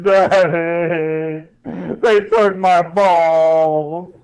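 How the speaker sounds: very slow, drawn-out whine; whining dog voice. Retold or imitated. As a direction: whimper it out like a sad neutered dog. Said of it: "He's like".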